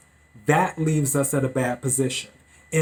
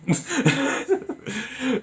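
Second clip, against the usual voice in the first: short higher-pitched laugh; 11 semitones higher than usual. Real, not acted. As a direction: laugh at your own stumble over a word. Laughs at own stumble on word.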